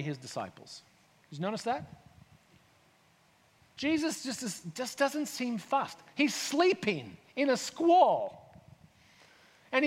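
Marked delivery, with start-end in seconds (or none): none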